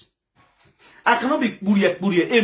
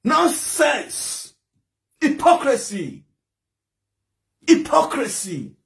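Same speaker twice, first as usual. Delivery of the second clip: higher, drawn-out contemptuous words; scornful denunciation; 5 semitones higher, much slower than usual. Real, not acted. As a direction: spit out each word as a verdict. Denouncing fake mourners on live.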